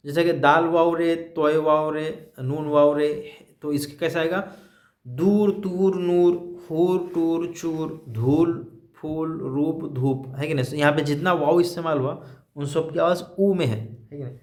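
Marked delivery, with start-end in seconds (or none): 5.1-9.9 slow, stretched demo of दूर and तूर with a long ऊ. Shown as a demonstration. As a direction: drag out the ऊ in each word like a teacher. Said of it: "वाव का तलफ़ुज़ ऊ की आवाज़ में". (ऊ की लंबी आवाज़ में धीरे-धीरे)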